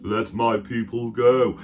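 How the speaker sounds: slow, solemn voice of God. Acted. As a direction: deliver a slow, drawn-out divine command. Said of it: "he tells Moses".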